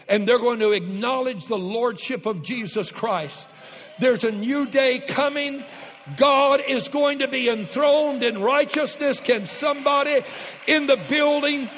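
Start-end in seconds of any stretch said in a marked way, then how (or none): 4.0-11.7 rising to a shouted call to the congregation; full-force preaching. Acted. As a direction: preach it higher and louder, up to a shout. Preacher building to a shouted climax.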